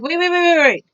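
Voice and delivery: high, quick alarmed cutoff; alarmed interruption. Retold or imitated. Cutting off the pharmacy technician, alarmed. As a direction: stop her mid-price in a panic. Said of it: "I'm like".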